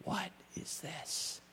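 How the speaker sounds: slow, soft, breathy whisper; whispered question. Acted. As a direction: let it fall to a hush. Hushed repeat of the refrain.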